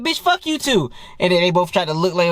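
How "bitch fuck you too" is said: high-pitched retold comeback; high, indignant mock insult; mock angry retort. Acted retort in retold quarrel.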